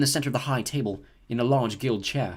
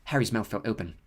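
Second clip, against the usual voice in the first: usual speaking voice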